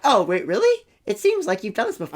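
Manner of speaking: a playful "wait, really?" gasp; mock surprise. Retelling his reaction to his friend, mock surprised.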